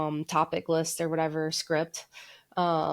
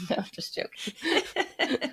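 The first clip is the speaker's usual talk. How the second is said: laughing; 8 semitones higher, slower than usual. Real, not acted. Laughing off her own joke.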